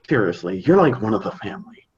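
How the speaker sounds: ordinary talk, nothing marked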